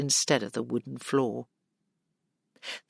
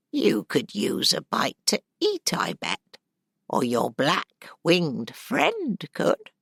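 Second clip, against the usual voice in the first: put-on a cackling old innkeeper woman welcoming her in; 3 semitones higher, slower than usual. Old woman offers food.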